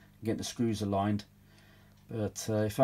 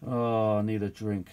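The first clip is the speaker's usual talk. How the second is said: ordinary talk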